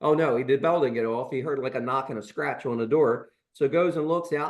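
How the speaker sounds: usual speaking voice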